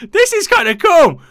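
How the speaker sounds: high, loud laugh into words; loud high delighted laughter. Real, not acted. Delighted by hotel roleplay.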